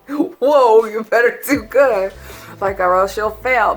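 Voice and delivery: loud mock-stern warning; strict teacher. Mock strict teacher warning her cake class, hammed-up. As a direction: play a hammed-up strict instructor threatening students. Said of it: "why'd I say".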